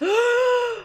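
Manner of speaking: sharp, very high mock gasp; dramatic gasp. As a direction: gasp as if revealing a big twist. Dramatic reveal of finale.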